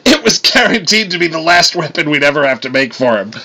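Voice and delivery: acted mirth after a guffaw; between guffaws. A narrator's reading. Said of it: "Eitri said between guffaws".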